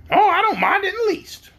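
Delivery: high-pitched, chatty hen voice; squawky-high talking hen; yellow hen. A narrator's reading. Storyteller voicing the yellow hen.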